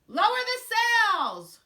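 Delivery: ordem lenta, aguda e muito alta na tempestade; capitão gritando. Acted. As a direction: grite por cima da tormenta. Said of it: "Braid Bear shouted".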